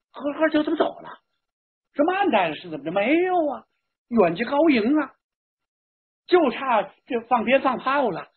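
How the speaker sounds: high-pitched, bewildered guests all asking at once; baffled clamour. A narrator's reading. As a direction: voice a roomful of stunned relatives. Baffled guests clamour after groom leaves.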